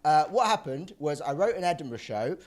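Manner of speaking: normal talking voice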